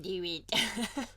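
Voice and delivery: lightly voiced sinister emperor; a hint of a creepy old emperor; Palpatine impression. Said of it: "Good impression".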